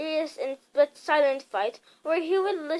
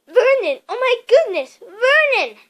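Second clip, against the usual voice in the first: high, loud panicked cry; shocked alarm; 9 semitones higher, 10 dB louder than usual. A narrator's reading. Aunt Petunia cries to her husband in horror.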